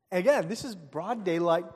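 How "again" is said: normal talking voice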